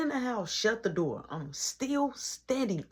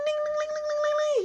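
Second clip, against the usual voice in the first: very high, ringing ding-ding sing-song; doorbell ding imitation; 6 dB louder, faster than usual. Narrator imitating the doorbell interrupting his brother, high ringing dings. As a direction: chime it like a doorbell going off.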